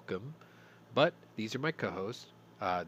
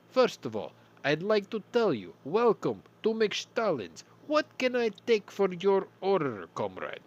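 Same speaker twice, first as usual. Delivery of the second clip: bad Russian accent; 9 semitones higher, 5 dB louder than usual. Podcast host plays a Soviet McStallin's cashier in a bad Russian accent. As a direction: ham up a fake Russian fast-food clerk. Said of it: "a really bad Russian accent".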